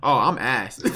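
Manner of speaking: normal talking voice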